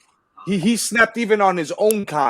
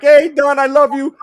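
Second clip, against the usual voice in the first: higher, louder crooner greeting; 10 semitones higher, 6 dB louder than usual. Retold or imitated. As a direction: call out warmly like a lounge singer. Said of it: "he'd be like".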